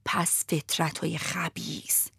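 slow hushed whispered echo; cat whispering. Acted. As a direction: whisper it back like a sly cat. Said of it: "گربهه تکرار کرد".

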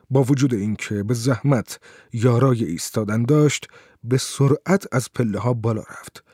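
plain reading voice

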